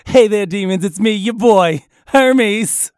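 loud, showy channel greeting; hammed-up internet host hello; vlogger intro. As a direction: punch it out like a streamer opening a video. Parody video host intro.

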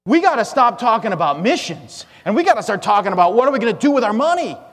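gasp, then fret it out in alarm; sudden alarm. Acted. Preacher mimicking ministries afraid of losing their excess, in mock panic. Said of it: "suddenly when they realize".

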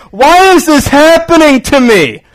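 high, loud anguished cry of a pregnant woman; desperate pregnant mother. Retold or imitated. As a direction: wail it like a frightened mom. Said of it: "what does she say?".

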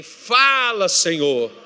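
loud cry with FALA pushed hard; fervent, full-voiced plea to God; forceful, convincing call. Shown as a demonstration. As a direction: call out "Fala" loud, then let "Senhor" drop in pitch. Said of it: "Não, tá fraco, nem convenceu".

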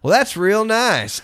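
put-on a sarcastic country boy sizing up a gun; Jim Varney deadpan. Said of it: "Jim Varney just goes".

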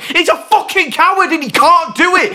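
high, heated tirade; furious rant. Real, not acted. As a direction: tear into him, voice climbing. Ranting about the dating vlogger.

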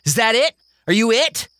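high, teasing victory; gloating girl. Acted. Mary gloating over Kingston, high and teasing. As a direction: rub it in.

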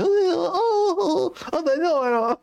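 squealed oh-oh of disbelief; mock shocked oh. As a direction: gasp in exaggerated disgust. Mock gasp at ugly show.

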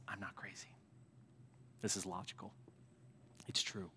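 very soft, near-whispered assurance; hushed insistence. Acted. Paul answering a charge of insanity, hushed and calm. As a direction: drop to a hush, stating it calmly.

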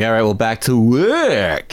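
slow, weird attempt at New York; New York accent drawn out oddly; botched New York accent. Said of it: "He says it so weirdly".

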